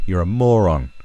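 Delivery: ordinary talk, nothing marked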